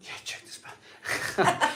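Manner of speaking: high breathy giggling echo; laughing. Real, not acted. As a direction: laugh the words out, slow and airy. Laughing repeat of friend's joke.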